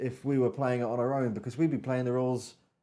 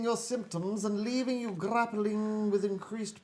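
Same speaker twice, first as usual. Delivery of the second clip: Victorian dandy voice; 7 semitones higher than usual. Acted. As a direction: read it like a fluting Victorian dandy. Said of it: "in your Victorian dandy voice".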